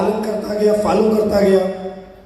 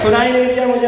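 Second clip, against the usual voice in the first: ordinary delivery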